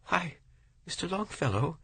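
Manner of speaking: high, pleading woman's voice; the widow pleads with the poet, high and imploring. A narrator's reading. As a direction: beg him, close to tears. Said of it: "she pleaded again and again".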